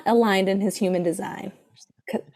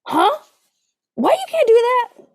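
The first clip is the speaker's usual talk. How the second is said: high, louder indignant huh; mock fiancé outburst; 13 semitones higher, 6 dB louder than usual. Imitating fiancé's objection, high and loud. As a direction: play the baffled protesting partner. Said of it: "his same reaction".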